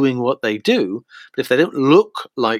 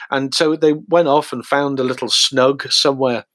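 ordinary talk

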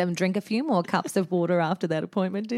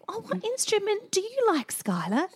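sweet mum voice; 11 semitones higher than usual. Retold or imitated. Mum voicing herself to child.

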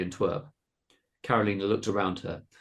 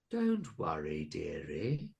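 soft creature voice, soothing; reassuring; 4 semitones lower, 8 dB quieter, much slower than usual. A narrator's reading. Monster comforting Carolina after her plea, reassuring. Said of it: "said Missus Cabbage reassuringly".

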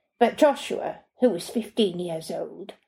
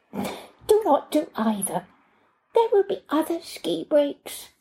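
lightly voiced foreign nanny; 7 semitones higher than usual. A narrator's reading.

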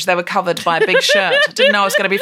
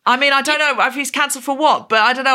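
ordinary talk

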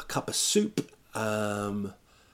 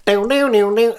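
loud, high mock hillbilly twang; exaggerated hillbilly gibberish; hillbilly accent; 10 semitones higher, 9 dB louder than usual. Mocking the Americans at the rally in hillbilly accent. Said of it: "It was a proper hillbilly".

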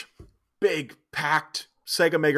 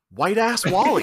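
proud reveal; 7 semitones higher, 4 dB louder, slower than usual. Acted. Alan Cumming's character revealing his old nickname to her, proudly.

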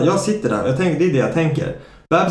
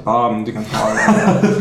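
imitating staff cracking up while answering; slow, laughing mock reply; slower than usual. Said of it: "De bara garvar typ".

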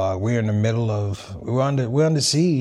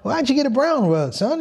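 high-pitched mock dad, baffled and scolding; imitating his dad; 9 semitones higher than usual. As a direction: play the confused father finding the prayer rug, pitched way up.

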